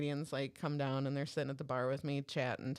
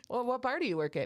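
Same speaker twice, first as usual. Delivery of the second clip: higher-pitched mock curiosity; smart-ass question; 7 semitones higher, 3 dB louder, faster than usual. Friend challenges self-proclaimed bartender, smart-ass. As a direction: needle him with fake interest. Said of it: "in her smart ass way".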